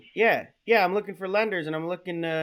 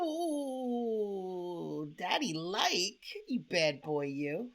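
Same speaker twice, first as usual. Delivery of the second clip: flirty; 7 dB quieter, much slower than usual. Acted. Prank caller flirting with telemarketer.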